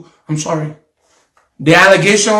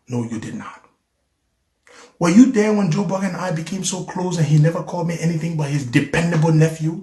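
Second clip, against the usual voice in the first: normal talking voice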